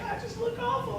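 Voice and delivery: very high, tiny imitated voice; Teresa. Woman who hates crying, imitated high and small. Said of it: "Teresa hates crying because she's like".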